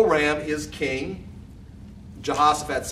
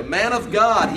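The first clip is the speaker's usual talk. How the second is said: emphatic appeal, louder; a touch of pleading in a read-aloud plea; begging; 6 semitones higher, 5 dB louder, slower than usual. A narrator's reading. Third captain falls on his knees to Elijah, begging. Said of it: "he begged".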